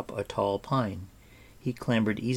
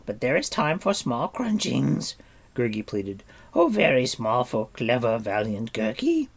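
higher, louder whiny creature voice; a little creature begging for food; whiny, pleading creature voice; pleading Gurgi creature; 3 semitones higher, 6 dB louder than usual. A narrator's reading.

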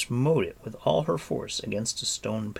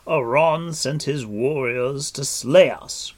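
loud, slow, higher accusation; indignant cry; 5 semitones higher, 8 dB louder than usual. A narrator's reading. Captive lord accusing the queen, crying out. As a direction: cry it out forcefully. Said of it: "cried Gwydion".

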